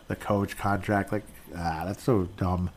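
normal talking voice

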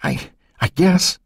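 a touch of scared stammer. A narrator's reading. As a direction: falter over the first word. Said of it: "Becky stammered".

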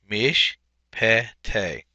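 "Mishpete" broken into mish, pe, te; three separated syllables in "Mishpete". Shown as a demonstration. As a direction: sound out "Mishpete" slowly, pausing between syllables. Teacher repeats word syllable by syllable.

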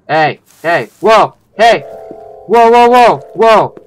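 loud, high startled shouts; alarmed calling out. Real, not acted. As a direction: call out in repeated bursts of alarm.

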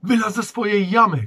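loud, high yell at the dragon; shout. Acted. Borek yells at the dragon in a loud, high shout. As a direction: call out to the beast, full voice. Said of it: "krzyknął Borek".

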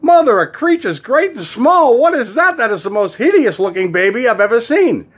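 high, loud, hammed-up horror; appalled outcry. Passerby looks into the carriage, appalled outcry. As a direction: cry out aghast, drawing out the opening.

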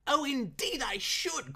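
high, slow, delighted; eager cry. Acted. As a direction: gush with delight. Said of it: "cried Mister Saltena".

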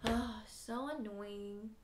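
low, reluctant grumble, sinking as it goes; grudging grumble. Acted. Teacher mimicking an unwilling giver for kids, grudging grumble. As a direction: grumble it out, dragging the final complaint. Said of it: "be mumbling and grumbling and like".

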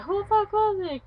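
very high mock furious question; hammed-up bad girl hunting the thrower; angry demand. Girl demanding who threw it, high mock angry voice.

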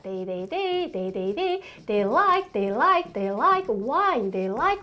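"they like wine" built up very slowly; slow model sentence. Shown as a demonstration. Teacher building the sentence for students, very slow. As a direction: drag out each word.

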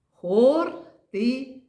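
ХОРТИЦЯ slowly in three beats, ХОР loud and high; syllable by syllable. Shown as a demonstration. Teacher splits word into syllables.

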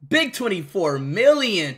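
normal talking voice

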